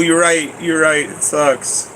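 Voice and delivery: ordinary delivery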